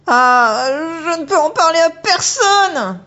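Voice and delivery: put-on high, loud, stretched-out girl's exclamation; girl exclaiming. Megumi exclaiming to Horatio after the otter vanishes, high and loud. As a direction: burst out in shock, slowly and high.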